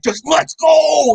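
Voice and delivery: high, slow yell to get moving; impatient shout. Retold or imitated. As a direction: bellow it, drawn out and impatient. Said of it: "Emmett being like".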